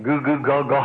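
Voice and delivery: lightly voiced baby talk, slowed; a hint of baby gurgling; imitating baby babble. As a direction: mimic a toddler trying to talk, fondly. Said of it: "she really makes a try".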